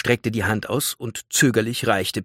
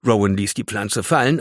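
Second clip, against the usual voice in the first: plain reading voice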